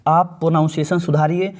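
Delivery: normal talking voice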